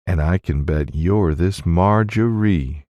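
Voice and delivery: ordinary delivery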